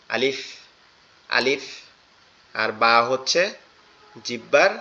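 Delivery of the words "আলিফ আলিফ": "আলিফ" said with a light breath of air; light airy alif. Shown as a demonstration. Teacher shows students a breathy "আলিফ". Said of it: "হালকা বাতাস বের হয়ে উচ্চারিত হবে".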